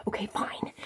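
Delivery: whispered reluctant okay; soft unvoiced whisper. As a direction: hush it like someone might hear. Whispered reply of friend.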